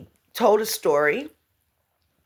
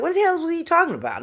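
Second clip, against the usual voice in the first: higher, fast, mocking puzzlement; baffled listeners; 9 semitones higher, much faster than usual. People puzzling over [name], fast. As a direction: rattle off the confusion. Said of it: "People say".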